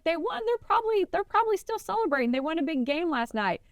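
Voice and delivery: high mock stranger voice; Texan man. Stranger tells her about the game, high mock voice. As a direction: lightly voice the cowboy. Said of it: "And he's like".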